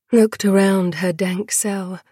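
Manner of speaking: usual speaking voice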